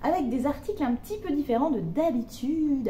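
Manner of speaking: ordinary talk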